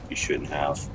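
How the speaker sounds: normal talking voice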